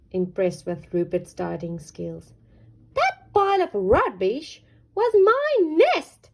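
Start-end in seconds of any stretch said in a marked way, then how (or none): 2.9-6.3 high, loud snapping duck; snapped. Acted. Dora scolds Rupert over her nest, high snap. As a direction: snap it out sharply. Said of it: "she snapped".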